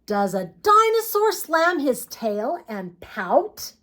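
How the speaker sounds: ordinary delivery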